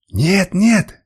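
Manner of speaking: high, loud hysterical denial. A narrator's reading. Woman denies she is a slave, hysterical. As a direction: cry out the denial, pitch jumping up. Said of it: "истерично повторила она".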